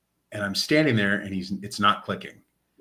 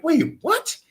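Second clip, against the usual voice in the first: much higher, incredulous wait what; high-pitched disbelieving double take; stunned disbelief. Retold or imitated. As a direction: squeak it out in total shock. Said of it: "I'm like".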